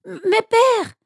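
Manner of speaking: protestation très aiguë du fils; insistance. A narrator's reading. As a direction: proteste d'une voix qui grimpe. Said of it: "insista-t-il". (fils qui insiste, voix très haute)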